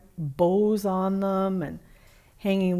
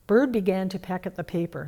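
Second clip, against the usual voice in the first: usual speaking voice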